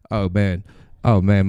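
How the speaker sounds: ordinary talk, nothing marked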